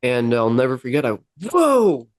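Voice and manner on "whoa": high-pitched startled whoa; shocked. Retold or imitated. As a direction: blurt a stunned, drawn-out exclamation, pitch jumping up. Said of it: "it just shocked me".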